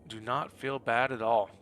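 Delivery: usual speaking voice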